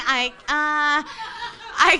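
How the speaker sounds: normal talking voice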